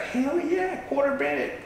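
all excited. Retold or imitated. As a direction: squeal it like a big win. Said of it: "And then you're all excited".

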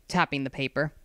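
ordinary delivery

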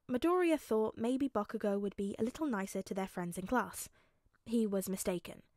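plain reading voice

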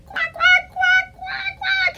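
high, far-off puppet duck quacking; duck quacks. Pretend. Librarian voicing her duck puppet for children, high quacks. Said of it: "It's Duck".

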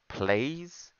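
"Plays" with an ay vowel, rising like a plea; mimicked misspelling. Player mimics opponent's typed plea, mimicked misspelling. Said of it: "He's saying plays".

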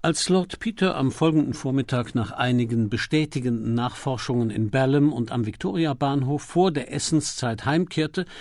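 usual speaking voice

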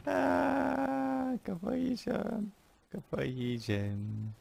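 long, exaggerated moan of defeat; mock despair. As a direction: whine softly as if losing your mind, stretching every word. Mock despair over wasted work.

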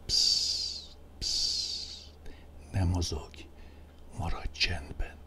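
soft unvoiced whisper, shushing; whispered shh, keep still; hushing whisper. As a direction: hush them like a spell. Repeated whispered hush, same level.

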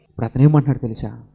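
ordinary talk

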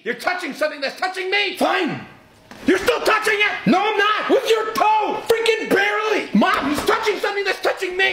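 put-on high shrieking kid squabble; whiny tattling little sibling.

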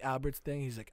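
usual speaking voice

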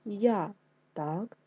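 usual speaking voice

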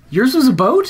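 high, loud disbelieving question; stunned it was a boat; incredulous. Real, not acted. Repeats disbelief louder.

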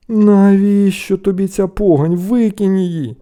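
slow, high, loud reproach; disgusted scolding. A narrator's reading. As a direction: order him to drop it. Brothers scorn the find.

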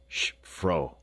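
mildly sharp hush; a touch of sharp reproof. A narrator's reading. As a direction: hush the wife curtly. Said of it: "said Raff sharply".

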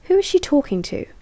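quick, high, loud demand; raven's snap. Acted. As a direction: bark it out like a suspicious guard. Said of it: "One of the ravens snapped".

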